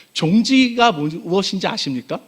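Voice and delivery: normal talking voice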